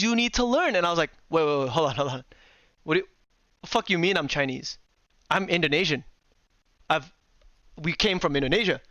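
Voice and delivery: ordinary talk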